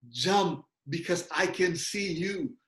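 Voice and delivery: father's slow, steady assurance; slow reassuring father. Retold or imitated. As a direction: promise her she's safe, slowly. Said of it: "kata-kata bapaknya yang bilang". (suara ayah yang pelan meyakinkan)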